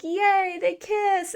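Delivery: high, squealing yay; excited cheer. Retold or imitated. Reader cheering the wedding kiss, high and thrilled. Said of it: "and you're like".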